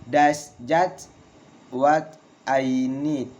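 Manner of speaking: slow, broken after "just", "need" falling; slow falling exclamation. Shown as a demonstration. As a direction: dictate it in pieces for learners. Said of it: "Valid intonation in exclamation".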